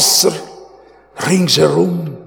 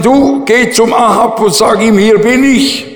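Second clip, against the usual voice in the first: higher, louder prophet's command; raised commanding voice; 7 semitones higher, 5 dB louder than usual. Retold or imitated. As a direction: call it out like a prophet sending a messenger. Elijah commanding in sermon story.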